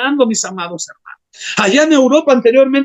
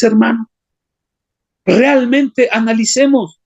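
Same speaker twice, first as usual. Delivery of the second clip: ordinary talk, nothing marked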